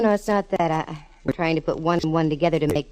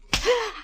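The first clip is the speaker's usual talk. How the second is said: sudden high frightened gasp; sharp gasp. Acted. Woman gasps as the sergeant attacks her, sharp frightened gasp. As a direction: gasp in shock as he grabs her.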